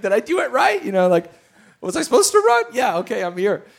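high, loud bewildered questions; flustered surprise. Retold or imitated. Son on first base asking what to do, high flustered voice. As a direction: sound lost and thrilled at once.

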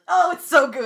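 high, loud creepy greeter; creepy cast member voice. Retold or imitated. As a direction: leer at the guests with a creepy grin. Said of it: "with that creepy face of just like".

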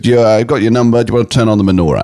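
lightly voiced rabbi, brisk and sure of himself; half-done impression of a confident rabbi; rabbi's voice. Rabbi inviting David to light the menorah, lightly mimicked. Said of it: "I'm doing the voice and everything".

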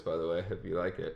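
ordinary talk

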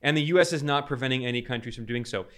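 ordinary talk, nothing marked

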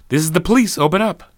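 firm shout through the door; loud police call. Acted. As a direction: bang on the door, commanding. Police knocking, calling out.

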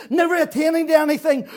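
usual speaking voice

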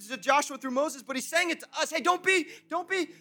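loud, high, urgent exhortation; full-force preaching. Real, not acted. Pastor urging the congregation not to worry, full-force preaching. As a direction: preach it at full voice, driving each command.

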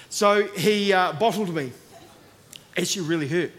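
usual speaking voice

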